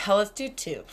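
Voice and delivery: laughing through the words. Real, not acted. Joking with listeners about pull-ups, laughing. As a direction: giggle at your own joke.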